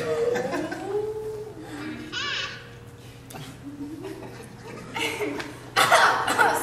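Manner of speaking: long wheezing laugh that cracks; losing it completely mid-sentence; breaking down into giggles; helpless laughing fit. Real, not acted.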